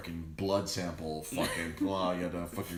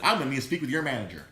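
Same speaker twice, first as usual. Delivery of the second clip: fast, louder, entitled mock Karen with a laugh; 5 semitones higher, 6 dB louder, faster than usual. Mocking a Karen demanding the manager, fast and loud. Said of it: "here comes talking to the manager".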